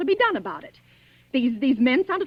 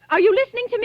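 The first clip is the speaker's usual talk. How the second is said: higher, louder, sharp and impatient; impatient demand; 7 semitones higher, 5 dB louder, faster than usual. Acted. Invalid wife demanding the sergeant listen, impatient. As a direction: snap at him to pay attention.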